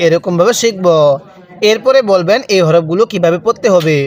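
ordinary talk

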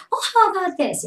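high, loud playful surprise; spring it on the kid cheerfully; mock excitement. Shown as a demonstration. Acting surprise trick. (pabiglang masayang gulat)

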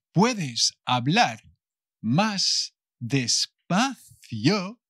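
very slow, stretched-out teaching model; muy despacio. Shown as a demonstration. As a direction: draw out each word, especially "más despacio". Models asking for slower speech. (muy despacio, alargando las palabras)

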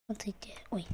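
hushed whisper. Real, not acted. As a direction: whisper to the viewers. Whispered to viewers.

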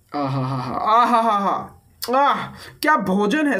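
slow drawn-out aah of delight; contented sigh. Pretend. Gamer relishing cooked pork, hammed-up aah. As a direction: savour it like a feast.

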